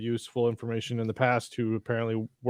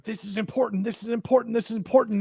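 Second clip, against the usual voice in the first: higher-pitched mocking repetition; mildly hammed-up insistence; 8 semitones higher, faster than usual. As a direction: imitate a show harping on its point. Imitating the show's repeated insistence.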